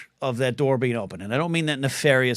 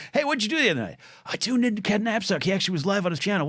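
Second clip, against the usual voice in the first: imagined friend's chat; 8 semitones higher, faster than usual. Pretend. Imagined chat between two friends.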